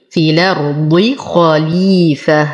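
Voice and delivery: slow recited في الأرض خليفة with full kha; heavy kha. Shown as a demonstration. Teacher reciting for students, slow خليفة with heavy kha. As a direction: measure out خليفة, thickening the kha. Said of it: "نفخم الخاء".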